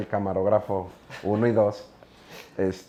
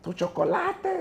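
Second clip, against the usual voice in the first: put-on little boy calling; slower than usual. Boy runs out calling after him.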